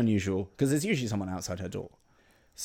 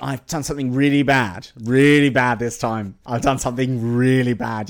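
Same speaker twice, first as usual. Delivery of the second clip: distressed; 2 semitones higher, 9 dB louder than usual. Acted. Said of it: "Harry's distressed".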